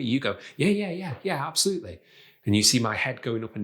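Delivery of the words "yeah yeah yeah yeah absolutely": high, hurried string of yeses; eager rapid yeses. Shown as a demonstration. Coach shows over-eager agreement to a request, eager and hurried. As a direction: agree too keenly, repeating yeah at a higher pitch. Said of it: "instead of when someone says something like".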